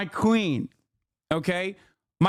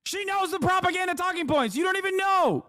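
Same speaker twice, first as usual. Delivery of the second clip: high-pitched comic exasperation; incredulous, squeaky-high put-down; mock indignation; 10 semitones higher than usual. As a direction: keep ranting in a hammed-up high voice. Replaying his indignation at the panelist.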